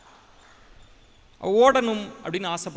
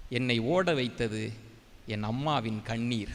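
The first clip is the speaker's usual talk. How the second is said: softer and lower, drawing out each word; slow, heartfelt reveal; 7 semitones lower, 5 dB quieter than usual. Retold or imitated. Wilma telling reporters her mother's tears made her run, slow and soft. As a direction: let the line land slowly and softly, like a confession.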